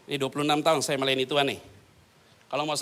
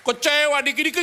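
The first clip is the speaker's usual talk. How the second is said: mock whiny complaint; 11 semitones higher, 9 dB louder than usual. Congregant complaining voice.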